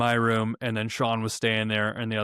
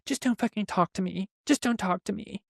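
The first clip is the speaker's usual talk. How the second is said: high-pitched imitation of someone upset; shaken, mimicked; 10 semitones higher than usual. Shaking housemate outside refusing to talk, imitated high upset voice. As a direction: mimic a rattled brush-off, pitched way up. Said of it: "he's, like, shaking".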